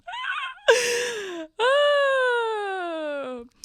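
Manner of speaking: long wailing laugh, near crying; helpless laughter in a long high squeal; high shrieking laugh. Real, not acted. Cracking up at own story.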